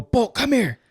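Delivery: calling the dog. Retold or imitated. Calling his old dog at gate.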